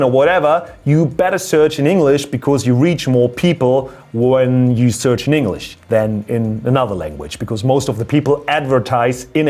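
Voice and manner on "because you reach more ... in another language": ordinary delivery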